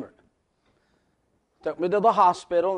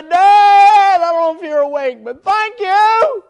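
loud, high holler, stretching out "thank you"; 16 dB louder, slower than usual. Retold or imitated. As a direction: holler your thanks through the house at night. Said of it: "I can hear him hollering".

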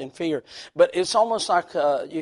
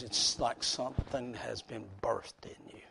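whispering; 7 semitones lower, 12 dB quieter than usual. Acted. Hushed aside in sermon.

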